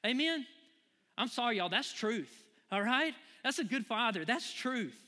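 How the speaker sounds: high, loud, driving sermon voice; full-force preaching. Acted. Pastor preaching to the congregation, full force. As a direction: preach it to the rafters.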